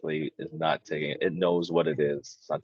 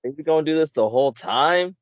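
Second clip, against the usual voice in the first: louder, higher, fed up; exasperated mock complaint; 9 semitones higher, 6 dB louder than usual. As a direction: sigh it out like you've had enough. Mocking annoyed cosplayers.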